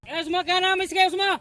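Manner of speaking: loud high call to someone. Real, not acted. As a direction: shout across to a friend. Calling out to a teammate. (दूर किसी को ज़ोर से पुकारना)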